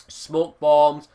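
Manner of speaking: ordinary talk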